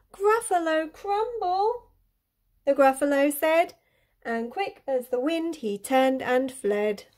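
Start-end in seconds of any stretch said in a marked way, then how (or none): 0.1-1.9 gasp, then slow high-pitched question; horrified echo. A narrator's reading. Gruffalo hears he will be eaten, horrified. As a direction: draw the words out in rising alarm.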